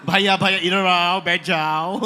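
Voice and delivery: loud, put-on South Mumbai Hindi. Host demonstrating bad Hindi, South Mumbai accent. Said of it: "So Sou-, South Mumbai Hindi".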